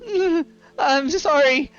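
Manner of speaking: high, whimpering apology; acted tearful, halting sobs; injured halfling apologising. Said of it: "And he goes".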